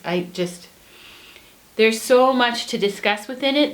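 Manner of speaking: ordinary talk, nothing marked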